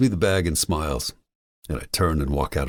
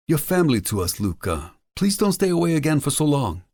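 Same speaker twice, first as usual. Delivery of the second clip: put-on high-pitched older restaurateur character, pleading fondly; fond, high-voiced welcome; affectionate Mario; 11 semitones higher than usual. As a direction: play Mario much higher than the narration.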